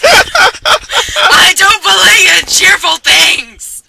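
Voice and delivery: slow, high hammed-up yell; drawn-out theatrical shout; mock shout. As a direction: bellow it in mock despair. Mock outburst mid reading.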